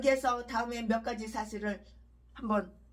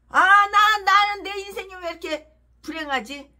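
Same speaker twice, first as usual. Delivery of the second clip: mock lament; 6 semitones higher, 9 dB louder than usual. Preacher voices a lamenting sinner.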